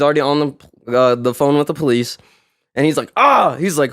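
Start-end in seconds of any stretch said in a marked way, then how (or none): 3.2-3.5 high mock yelp of a hurt rider; imitated cry of pain. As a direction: replay his sharp cry of pain. Injured rider cries out.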